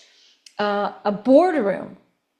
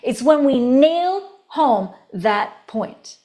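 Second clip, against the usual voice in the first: slow, punchy diction that drives the point home; percussive emphasis; 3 dB louder, slower than usual. Shown as a demonstration. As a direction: hammer out each word, slow and loud. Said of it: "The percussiveness of our diction is the power".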